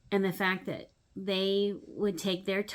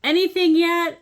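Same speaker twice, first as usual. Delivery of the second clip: higher, louder call across the room; shout from the door; 9 semitones higher, 10 dB louder than usual. Retold or imitated. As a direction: call out from the doorway. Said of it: "shout from the door".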